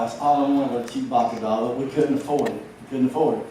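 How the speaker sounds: ordinary talk, nothing marked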